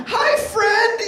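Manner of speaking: put-on very high, loud little boy call; adorable gleeful kid. Son greeting strangers at school, high and gleeful. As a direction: chirp a hello like an eager child. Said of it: "it was the most adorable thing".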